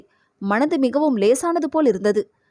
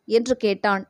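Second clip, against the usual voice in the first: plain reading voice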